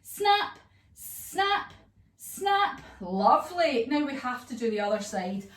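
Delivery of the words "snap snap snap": each snap popped high; high snap sounds. Shown as a demonstration. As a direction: snap it up high like jaws closing. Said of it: "we go snap".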